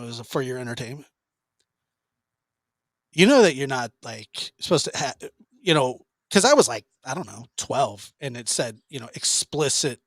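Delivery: ordinary talk, nothing marked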